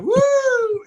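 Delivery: high, loud mock siren whoop; imitated siren whoop. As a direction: call out woo like a police siren. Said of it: "And they're like".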